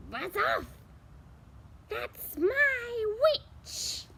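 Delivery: high, slow, crying monster shout; beast roar. Acted. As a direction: howl it like a shrieking beast. Beast scares off the dragon.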